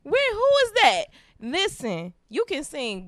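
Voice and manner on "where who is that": high, startled mock questions; mock surprise. Hosts replay their surprise at his singing, high and startled. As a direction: act out being caught off guard. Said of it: "and we like".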